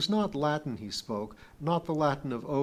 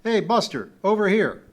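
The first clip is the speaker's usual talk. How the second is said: loud, high-pitched holler; shout; 8 semitones higher, 11 dB louder than usual. A narrator's reading. Man across the corridor hailing Kip, shouting. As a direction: call out loudly to get attention. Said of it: "a loud whistle and a shout".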